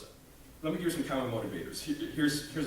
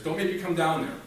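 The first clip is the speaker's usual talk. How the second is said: louder, raised mock shout; dad shouting; 6 semitones higher, 8 dB louder than usual. Dad shouts down from upstairs, louder and higher. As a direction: call it down the stairs as a warning. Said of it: "he'd shout from upstairs".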